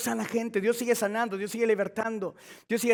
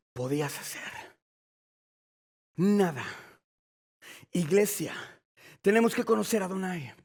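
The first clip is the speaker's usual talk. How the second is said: usual speaking voice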